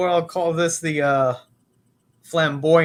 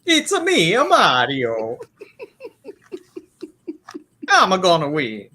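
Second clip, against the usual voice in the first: high, louder cartoon Italian accent; Mario Italian accent; 6 semitones higher, 4 dB louder than usual. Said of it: "I have the Italian accent".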